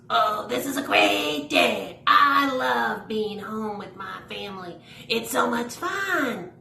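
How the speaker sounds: lightly voiced cheerful puppet, a bit slow; a hint of a happy stuffed animal; puppet voice for Harry. Puppeteer voicing a stuffed animal for kids, lightly cheerful. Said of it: "this is little Harry, and Harry goes".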